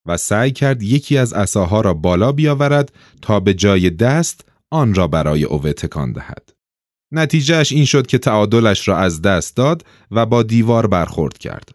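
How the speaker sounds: ordinary delivery, nothing marked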